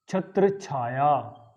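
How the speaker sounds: छत्र छाया slowly as two words; छत्र छाया with a single, undoubled chh; common mispronunciation. Shown as a demonstration. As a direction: say छत्र छाया as "chhatra chhaya". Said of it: "ये बोलते हैं".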